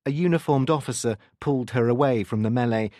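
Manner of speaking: usual speaking voice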